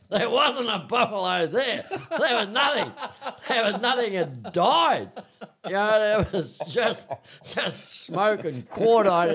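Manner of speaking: high voice, words broken by laughter; can't hold back the laughs at the punchline; real laughter through the words; laughing. Real, not acted. Laughing at the failed hunt.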